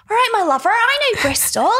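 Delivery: high, hammed-up Bristol accent; mock Bristol accent. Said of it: "I was making fun of his accent".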